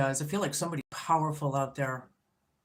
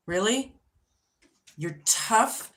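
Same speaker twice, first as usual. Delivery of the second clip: mocking disbelief; 5 semitones higher, 4 dB louder, slower than usual. Real, not acted. Mocking a tough person.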